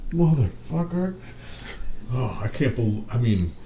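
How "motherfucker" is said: stunned curse. Retold or imitated. Stunned reaction after the hit.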